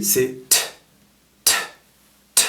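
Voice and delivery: crisp t, t, t said separately; isolated t sounds; American T. Shown as a demonstration. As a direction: tap out each t cleanly. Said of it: "Et le T".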